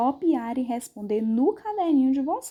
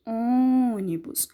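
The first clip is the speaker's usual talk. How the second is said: "Ônibus" with a long closed Ô; stressed closed vowel; much slower than usual. Shown as a demonstration. Teacher shows the mouth barely opens, Ô stretched in "Ônibus". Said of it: "eu quase não abro minha boca".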